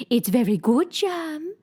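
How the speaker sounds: high, slow fussy queen voice; lightly voiced queen, insisting. Queen to Alice, lightly voiced fussy queen.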